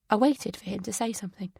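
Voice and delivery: ordinary delivery, nothing marked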